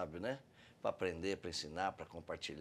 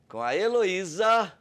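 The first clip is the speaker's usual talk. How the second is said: raised, louder guest announcement; excited introduction; 8 semitones higher, 10 dB louder than usual. Real, not acted. Host introducing the family to listeners, excited announcement. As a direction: sing out the guest's name, high and loud.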